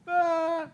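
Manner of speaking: gemido agudo y fuerte de llanto fingido; llanto imitado. Retold or imitated. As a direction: imita un sollozo agudo, exagerado. (llanto fingido, en voz alta)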